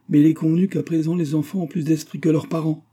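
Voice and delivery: very piqued. A narrator's reading. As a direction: retort with hurt pride. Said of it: "fit madame Karsdorff très piquée".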